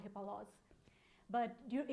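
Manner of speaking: ordinary talk, nothing marked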